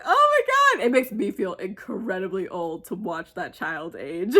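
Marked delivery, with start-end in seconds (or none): 0.0-0.9 much higher, louder teary squeal. Retold or imitated. Fan overwhelmed watching a child grow up, teary squeal. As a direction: let it crack into a near-sob. Retelling crying over child growing.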